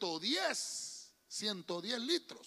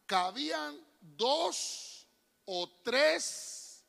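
slow drawn-out reading; much slower than usual. Real, not acted. Preacher reads the verse to the church, drawn out. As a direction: stretch each word, very slow. Reading scripture slowly, stretched.

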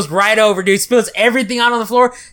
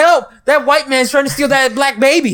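higher, mock-panicked cry for help; mock onlooker alarm; 7 semitones higher than usual. Shoppers imagining a kidnapping, mock alarmed cry. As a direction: play a startled bystander calling for help. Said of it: "They're like".

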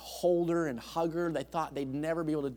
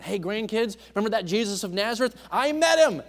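lightly voiced old man, eager and high; a hint of a grandpa bragging to the kids; old grandpa storyteller; 8 semitones higher, 9 dB louder than usual. Old man telling his grandchildren he met Jesus, lightly voiced and eager. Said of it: "an old man ... telling the story".

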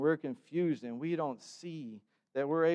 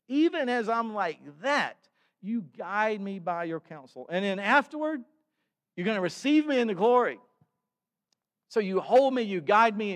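rising, louder preacher's build toward glory; building preaching; 4 semitones higher, 7 dB louder than usual. Acted. Preacher lifting the congregation toward glory, building preaching.

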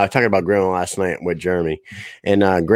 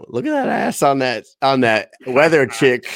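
a hint of a leering old man; lightly voiced grandpa; 4 semitones higher than usual. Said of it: "He said".

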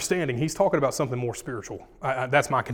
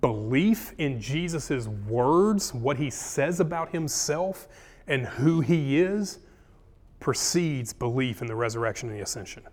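slow, weighty emphasis on each phrase; slow and deliberate. Shown as a demonstration. Teacher slowing down so the class hears the key point clearly. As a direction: lay it out slowly so every word lands. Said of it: "a point that I want you to hear clearly".

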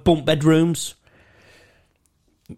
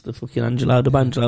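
normal talking voice

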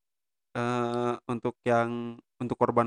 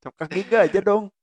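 laughing; 7 semitones higher, 4 dB louder than usual. Real, not acted. Joking about the unbranded drink.

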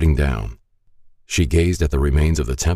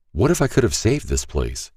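ordinary delivery, nothing marked

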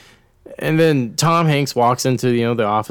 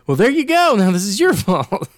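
high, loud smug boss voice; mock blaming boss; 10 semitones higher, 5 dB louder than usual. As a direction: gongola mentre scarica la colpa.

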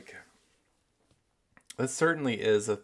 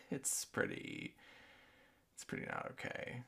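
whispering; 3 semitones higher, 12 dB quieter than usual. Real, not acted. Whispered aside after laugh.

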